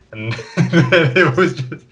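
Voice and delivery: laughing through the words; breaking up mid-sentence with laughter. Real, not acted. Laughing while telling the story.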